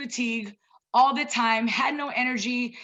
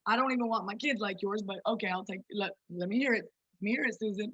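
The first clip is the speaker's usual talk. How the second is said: lower, softer sarcastic aside; mock snippy reply to advice; 3 semitones lower, 5 dB quieter than usual. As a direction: brush off the advice with a dry tone. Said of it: "we like".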